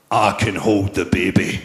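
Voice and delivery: low, slow, flat mock voice of a mate; an ex-con friend's dry offer, voiced low; deadpan pal. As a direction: drop low and slow, totally unbothered. Pal Gary's deadpan reply.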